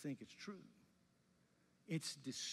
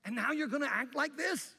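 much higher, louder scolding; exasperated rebuke; 9 semitones higher, 7 dB louder than usual. Retold or imitated. Paul scolding the church that strayed, exasperated loud rebuke. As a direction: rebuke them like a let-down teacher. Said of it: "he's saying to these people".

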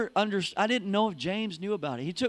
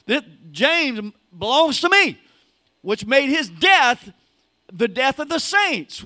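louder, rising sermon climax; building, louder preaching; full-force preaching; 3 semitones higher, 9 dB louder than usual. Acted. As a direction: drive it home to the congregation.

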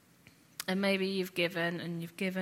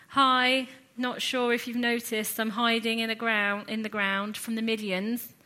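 raised, louder mock-helpful sarcasm; a little sarcastic; 4 semitones higher, 6 dB louder than usual. Preacher as Gideon hiding from the Midians, a little sarcastic. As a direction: point out the obvious with mild sarcasm. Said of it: "being a little bit sarcastic".

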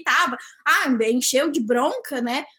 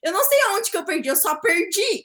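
higher, insistent feigned bewilderment; playing clueless; 4 semitones higher than usual. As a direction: protest as if genuinely clueless, hammed up. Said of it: "me dei uma de louca aqui".

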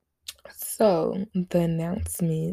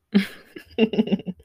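short laugh; 3 semitones higher, 5 dB louder than usual. Real, not acted. Laughs after welcome home.

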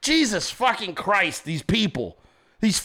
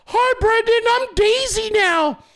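very high falsetto; mock girly voice; 12 semitones higher, 3 dB louder than usual. Worker greeting the boss as Daisy, mock girly falsetto. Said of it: "If you don't stay in character".

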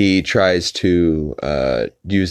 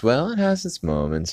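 higher mock-wife lilt; Lois; 7 semitones higher, 4 dB quieter than usual. Lois judging a movie in twenty questions, higher mock lilt. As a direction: lightly imitate a cartoon mother, pitched up. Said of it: "Lois says".

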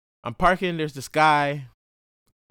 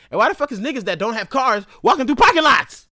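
ordinary delivery

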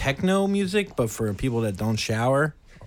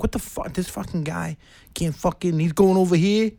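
indignant mock New Yorker rant; New York attitude; 7 semitones higher than usual. Fan griping about the man crowding him, New York attitude. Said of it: "this New York attitude at the beginning".

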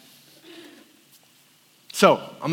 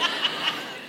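a soft, breathy self-mocking laugh; real little laugh without a word; breathless laugh; 9 dB quieter than usual. Real, not acted. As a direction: chuckle at your own distraction. Laughing at himself.

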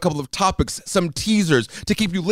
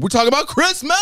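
excited; 12 semitones higher than usual. Real, not acted. Announcing the topic excitedly.

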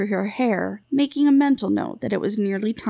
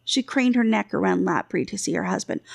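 plain reading voice

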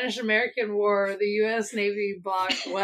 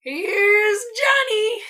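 high, slow, loud menacing call; drawn-out crazed villain line; horror movie villain; 12 semitones higher, 4 dB louder, much slower than usual. Acted. Quiz line read, then acted.

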